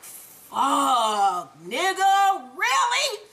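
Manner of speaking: mock exasperated disbelief. Ghost with guns pointed on him, exaggerated exasperated disbelief. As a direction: stretch the first word, then pitch up incredulously.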